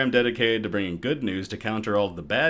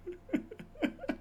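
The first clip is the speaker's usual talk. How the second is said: high laugh; 10 dB quieter than usual. Real, not acted. Laughs at beer name.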